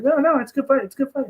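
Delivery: high-pitched, overly nice fighter; Stipe. Retold or imitated. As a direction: reassure in a small, high impression voice. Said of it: "he's like, he's like".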